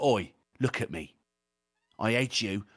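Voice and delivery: low, quiet pub-hardman threat; venomous British menace. Shown as a demonstration. Angry bald man alone in a pub, venomous British menace. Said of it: "that certain venom to it as well".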